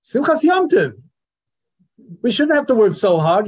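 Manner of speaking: mock the pious grumbler, pitch up; mock pious complainer. A teacher mimics the wicked son's pious excuse, mock whine. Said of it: "how does he put it".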